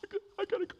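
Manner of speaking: high, rushed, panicky woman; frantic lady. Retold or imitated. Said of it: "And she's a mess".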